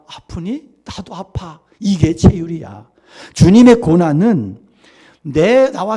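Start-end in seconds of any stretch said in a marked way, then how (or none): 0.1-1.6 tender whisper. Retold or imitated. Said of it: "끌어안고 있던 애가".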